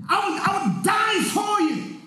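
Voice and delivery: high, fervent pledge; acted devotion pitched right up; impassioned vow. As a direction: cry it out as a sworn promise. Acting Peter's passionate vow to Jesus.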